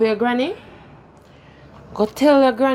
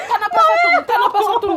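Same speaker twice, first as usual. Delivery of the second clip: angry demand; faster than usual. Acted. Mother demanding her daughter answer her.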